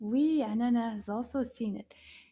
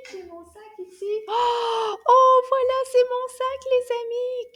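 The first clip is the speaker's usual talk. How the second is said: much higher and much louder, bursting with excitement; excited reveal; 11 semitones higher, 9 dB louder than usual. Acted. Teacher revealing her bag to the kids, high and loud. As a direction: shout it out like a big surprise.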